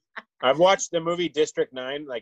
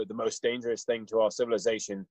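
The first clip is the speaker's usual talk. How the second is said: put-on slightly lower, softer British-accented quote; attempted British accent; 5 semitones lower, 6 dB quieter than usual. Quoting Elon Musk to cohosts, attempted British accent. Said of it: "so that accent is not, not very well".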